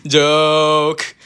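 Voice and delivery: loud, laughing "Joke!". Real, not acted. Vlogger admitting the baby story was a joke, laughing. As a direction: reveal the prank laughing.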